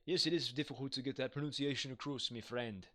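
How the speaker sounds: a hint of a Scottish accent, half-joking; an amateur Scottish accent put on for the chat. Shown as a demonstration. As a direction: repeat the line in a light mock Scottish accent. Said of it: "my attempt at, uh, having a, I guess, a, like, a Scottish accent".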